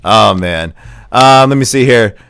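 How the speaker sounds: usual speaking voice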